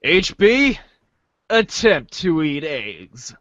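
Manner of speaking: ordinary talk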